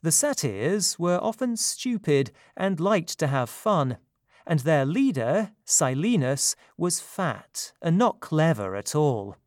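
usual speaking voice

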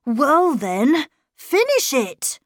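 put-on a child daring her to go on; challenging boy. A narrator's reading. Boy dares the governess to finish the letter.

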